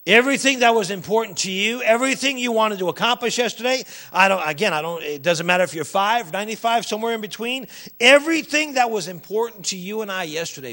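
punchy, high-energy sermon voice; full-force preaching. Real, not acted. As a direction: drive it home loudly to the congregation.

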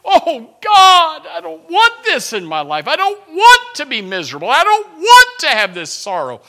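high, loud cry to God; anguished prayer. Retold or imitated. Preacher voicing the burdened person begging God, loud anguished cry. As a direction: plead at full voice, each refusal insistent.

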